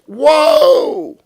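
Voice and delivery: quick loud high "Whoa!"; startled whoa. Retold or imitated. Racer caught off guard, startled whoa. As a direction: yelp of surprise as he spins. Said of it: "And he goes".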